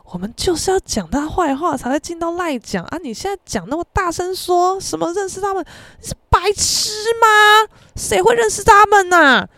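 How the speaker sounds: rising exasperation ending in a loud, high outburst; exasperated scolding. Retold or imitated. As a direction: lose patience with her step by step. Exasperated friend scolds roommate.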